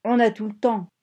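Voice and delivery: loud, insistent retort; shouting back. A narrator's reading. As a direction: shout down the panicked passenger. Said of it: "cria Bob à son tour". (réplique criée avec force)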